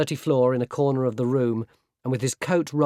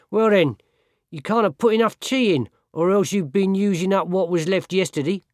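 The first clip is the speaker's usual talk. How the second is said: higher, louder complaining labourer; nagging labourer; 6 semitones higher, 3 dB louder than usual. Acted. Labourer complaining to the apprentice about weak tea, higher nagging voice. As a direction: needle the boy, whining and pushy. Sawkins needling the boy.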